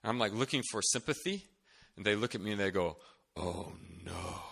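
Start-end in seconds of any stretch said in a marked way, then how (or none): 3.3-4.4 soft, low, drawn-out foreboding; hushed dread. Retold or imitated. Upperclassmen hearing about honor court, hushed dread. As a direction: let it sink out softly in doom. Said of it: "Like that".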